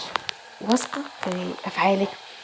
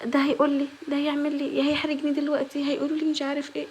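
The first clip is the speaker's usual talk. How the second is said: lightly higher, fretting self-talk; insecure inner voice; 6 semitones higher than usual. Retold or imitated. Vlogger acting out a timid girl's anxious thoughts, lightly higher. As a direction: voice the worried thoughts of a shy girl. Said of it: "ما تبصيش باللي هو ايه".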